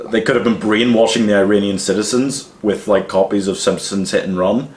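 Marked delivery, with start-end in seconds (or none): none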